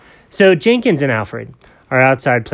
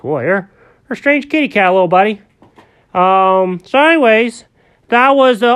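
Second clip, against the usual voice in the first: higher, louder friendly grown-up character; Alfred's kindly voice; 9 semitones higher, 9 dB louder than usual. Acted. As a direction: talk brightly to a little buddy. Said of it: "And Alfred goes".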